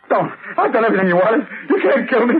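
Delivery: rising, desperate plea for life; panicked pleading. Acted. Butler begging Arnheim not to have him shot, panicked. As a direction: beg not to be killed, voice climbing.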